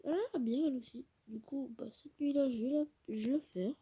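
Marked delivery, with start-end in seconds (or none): none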